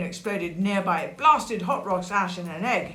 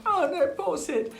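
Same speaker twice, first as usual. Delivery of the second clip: high, sympathetic lament; mock dismay; 10 semitones higher than usual. Storyteller feeling sorry for Sid, high dismayed voice. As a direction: coo pityingly in a raised pitch.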